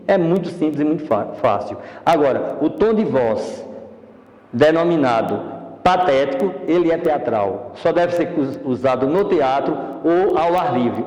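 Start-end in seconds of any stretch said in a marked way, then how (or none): none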